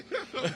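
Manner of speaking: bright burst of laughter; high laugh. Real, not acted. Host laughs at joke.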